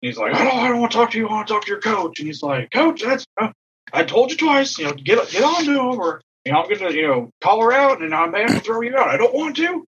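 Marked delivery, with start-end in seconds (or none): 0.3-2.2 high-pitched, worked-up refusal to listen; retold scolding, pitched well up; acted irate umpire. Umpire storms up to scold coach. 2.7-9.8 high, worked-up mock warning; retold exasperation, pitched well up; exasperated umpire. Umpire warns coach after storming up.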